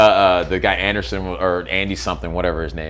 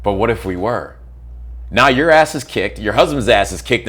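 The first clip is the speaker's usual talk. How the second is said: ordinary talk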